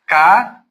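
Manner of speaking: "Ca-za" split in two beats with a z sound; syllable by syllable. Shown as a demonstration. Teacher to pupils, splitting casa into syllables.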